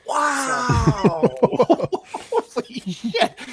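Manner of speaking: loud, stunned laugh through the words; laughing in shock. Real, not acted. Host reacting to a guest's edgy joke, laughing in shock.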